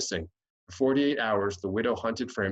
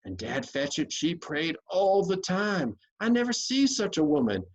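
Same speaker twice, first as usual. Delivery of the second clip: a hint of aggrieved irritation, higher; irritated Huck; 7 semitones higher than usual. Huck airing his grievance about the widow to Tom. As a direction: grumble with injured pride. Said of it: "with a spasm of special irritation and injury".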